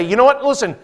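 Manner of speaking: high, loud earnest declaration; impassioned statesman's appeal; ideal statesman. Retold or imitated. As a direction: speak up as a selfless statesman. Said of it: "statesmen who will say".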